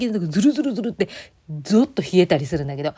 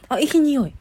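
high, louder burst of real delight at the scent; delighted sniff; 7 semitones higher, 4 dB louder than usual. Real, not acted. Sniffing the new wash, she gushes, higher and louder. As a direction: gush over a lovely smell, pitched up.

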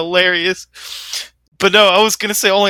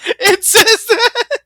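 laughing; 3 dB louder, much slower than usual. Real, not acted. Laughing disbelief.

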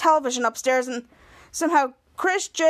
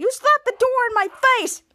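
high, raised-voice sibling outrage; acted angry accusation; 11 semitones higher, 4 dB louder than usual. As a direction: accuse her in a squabbling yell. Brother accuses sister in quarrel.

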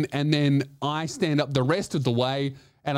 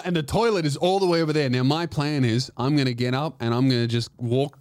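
normal talking voice

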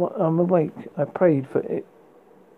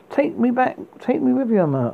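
a sudden desperate outburst, pitched high; blurted plea; 8 semitones higher than usual. A narrator's reading. Girl begging to be taken along, high blurted plea. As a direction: blurt it out, much higher and urgent. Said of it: "I blurted".

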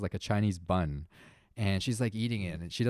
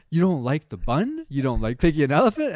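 exasperated dad; 5 semitones higher, 6 dB louder than usual. Retold or imitated. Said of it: "I was like".

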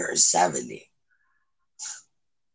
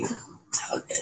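whispered reassurance; 12 dB quieter than usual. Real, not acted. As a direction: breathe it softly, voiceless. Soothing repeat to friend.

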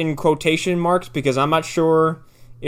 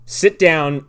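ordinary talk

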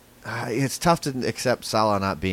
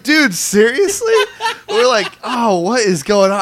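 high, loud, slow mock outrage; disgusted outcry; 11 semitones higher, 7 dB louder, slower than usual. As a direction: yell it like the smell just hit you. Friends react to fart in crowd.

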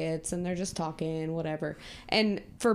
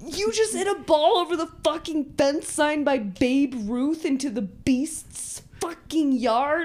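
louder, higher, incredulous yell; mock outrage; 7 semitones higher, 6 dB louder than usual. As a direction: blast him for losing the ball. Friends outraged at Smalls.

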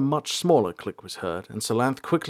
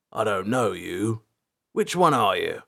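gruff caretaker. A narrator's reading. Caretaker challenges an unknown ranger in his hall, gruff. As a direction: ring it out gruffly, taking your time. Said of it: "the gruff voice rang across the room".

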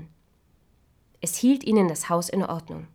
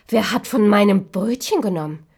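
louder, pressed outrage; loud indignant question; 2 semitones higher, 7 dB louder than usual. Acted. Third dwarf finding his bread touched, loud and indignant. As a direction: demand it loudly.

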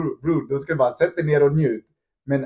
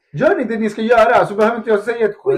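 louder and higher, firm coach telling off the team; retold coach shout; 8 semitones higher, 9 dB louder, faster than usual. Coach to his players about doing their job: loud, firm and higher. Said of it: "jag har sagt det så här".

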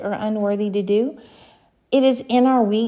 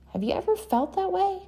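ordinary delivery

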